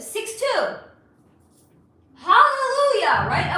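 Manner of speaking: high, loud cry of delight; overjoyed. Real, not acted. Telling followers about a six-two European match, thrilled cry. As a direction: shout it like a prayer answered.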